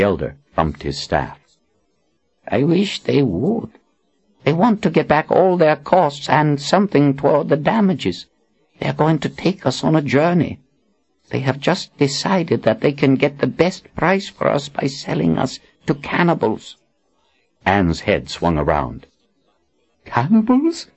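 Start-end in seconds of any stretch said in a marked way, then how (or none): 2.5-16.8 put-on higher, grave old wizard. Zed's voice in dialogue. 20.0-20.9 high shocked question. Acted. Anne shocked.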